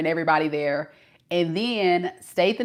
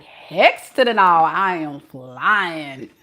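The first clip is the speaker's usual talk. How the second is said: comic flat refusal; 4 dB louder, much slower than usual. Retold or imitated. Answering the long-distance drivers, emphatic comic refusal. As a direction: refuse big, then stretch out the last words.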